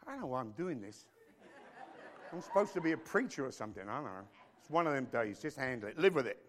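ordinary talk

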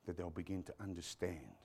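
low, soft, hushed closing prayer. Real, not acted. Preacher praying for congregation's understanding, hushed prayer.

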